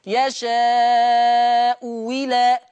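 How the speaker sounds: يشاء إلى with the second hamza turned into a kasra-voiced w, wi-la; hamza as waw. Shown as a demonstration. Teacher chanting the waw substitution in Quran style. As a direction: stretch يشاء إلى slowly, loud, as yashaa-u wila. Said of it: "يبدلها واو مكسورة".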